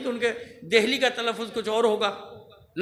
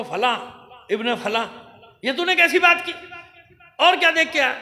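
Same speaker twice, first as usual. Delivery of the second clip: loud, higher furious rebuke; angry rebuke; 4 semitones higher, 7 dB louder than usual. Acted. Enemy commander berating his scout, angry rebuke. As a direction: tear into him for the insult.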